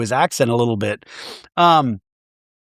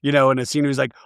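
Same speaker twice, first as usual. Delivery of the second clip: normal talking voice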